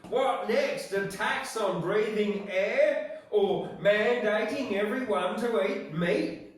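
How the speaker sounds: loud, high mock outrage; impassioned protest speech. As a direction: declaim it like a fiery protester. Poem climax, mock protest speech.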